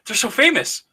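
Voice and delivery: very high, starstruck protest; imitated disbelief. Sister protests her sibling knows famous podcasters, imitated disbelief. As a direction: act out the sister's amazement.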